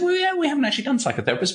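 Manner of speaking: high, quick conceding answer; hedging editor. Retold or imitated. Editor admits a gap to the researcher, hedging.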